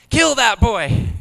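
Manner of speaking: put-on high, slow, drawn-out kid's demand; imitating furious son. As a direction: stretch each word like a defiant little boy. Son's stubborn angry answer.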